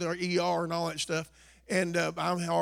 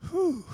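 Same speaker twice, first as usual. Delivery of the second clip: whew of relief; 12 semitones higher than usual. Acted. Relief after a hard week.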